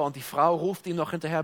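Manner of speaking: ordinary talk, nothing marked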